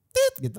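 high beep imitation; censor beep. Shown as a demonstration.

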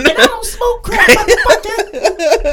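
high, slow, laughing through the line; cracking up in a high squeaky caller voice. Pretend.

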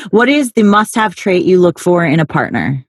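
usual speaking voice

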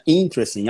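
"Interesting" as IN-tres-ting; "Interesting" shortened to three syllables, first one stressed; correct tonic syllable. Shown as a demonstration. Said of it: "A certa é interesting".

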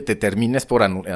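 usual speaking voice

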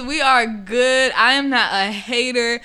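slowed, measured reassurance; slow, pointed reassurance to listeners; slow deliberate. Real, not acted. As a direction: set the record straight slowly.

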